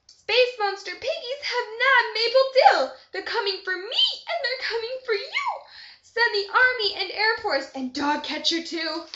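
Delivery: high, loud panicked phone call; loud shrill scream. Acted. Lorna May Loon screams into her phone to call the army. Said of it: "screamed loud and shrill".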